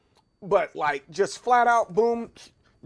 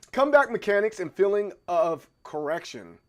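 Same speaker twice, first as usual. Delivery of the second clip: normal talking voice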